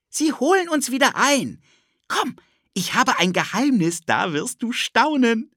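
put-on high, eager little-boy voice; a pushy kid tugging at his friend; cheeky boy Ted. Ted pulls Philipp along, eager high boy voice.

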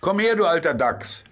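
a high, loud call across the bank. A narrator's reading. The rat calls the badger out of the hedge, loud and high. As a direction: hail the badger from a distance. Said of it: "rief die Wasserratte".

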